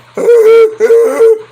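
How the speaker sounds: big shrieking laugh; loud high laugh. Real, not acted. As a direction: burst out laughing loudly.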